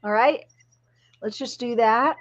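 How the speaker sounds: ordinary talk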